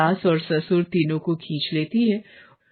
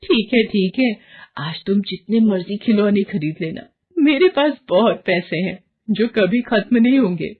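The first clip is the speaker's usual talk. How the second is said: greedy excitement; 2 semitones higher than usual. Acted. Varsha dismissing her husband, giddy about shopping. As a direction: brush off the warning, thrilled with money. Greedy wife brushes off warning.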